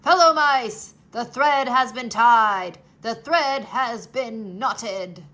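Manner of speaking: loud proclamation to the crowd; shouted. Acted. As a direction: announce it to the whole crowd. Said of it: "turned and shouted".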